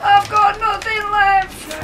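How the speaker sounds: high, strained cry of panic; panicked high cry. Real, not acted. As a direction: wail it out in desperation. Building panic while repeating the phrase.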